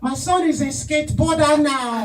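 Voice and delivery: mock an African mother scolding her son; high-pitched disappointed Nigerian mom. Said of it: "She's like".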